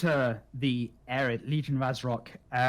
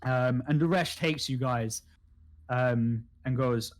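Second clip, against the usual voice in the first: normal talking voice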